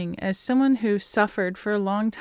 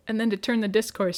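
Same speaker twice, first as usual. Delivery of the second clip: normal talking voice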